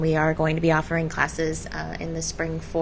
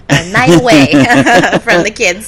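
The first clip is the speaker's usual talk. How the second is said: loud laugh through the words; 16 dB louder than usual. Real, not acted. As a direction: burst out laughing mid-sentence. Joke about mothers needing break.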